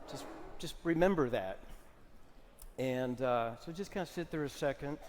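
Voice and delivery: soft and low, letting the thought sink in; quiet reminder of mortality; hushed meditation guide; solemn guided reflection. Acted.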